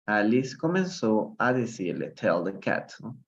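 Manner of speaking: ordinary delivery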